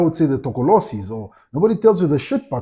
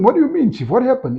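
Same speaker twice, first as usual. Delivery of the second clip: high, startled question, pitch well up; concerned surprise; 11 semitones higher, 3 dB louder than usual. Retold or imitated. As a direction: sound caught off guard and worried. Asking friend what happened.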